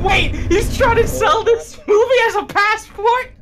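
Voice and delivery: high, loud, drawn-out disbelief; incredulous outburst. Real, not acted. Host reacts to a clip's sales pitch, incredulous outburst. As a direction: blurt it out high and loud, aghast.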